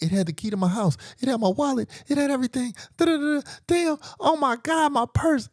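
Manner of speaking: high, loud, frantic listing of losses; hysterical. Retold or imitated. Woman tells him what her purse held, hysterical. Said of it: "now she's going hysterical".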